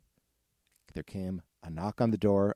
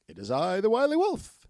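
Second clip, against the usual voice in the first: high, loud sly wolf; 12 semitones higher, 5 dB louder than usual. Acted. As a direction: announce yourself like a sly wolf. Wolf at second door.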